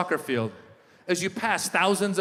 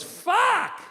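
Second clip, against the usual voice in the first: put-on high-pitched little girl swearing; one exasperated high toddler curse; frustrated tiny-kid outburst; cursing toddler.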